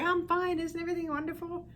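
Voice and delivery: high, fast, falsely cheerful ego; lightly voiced ego cheerily brushing things off; chirpy ego voice. Ego tells the listener everything is fine, high and fast. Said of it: "it's gonna be like".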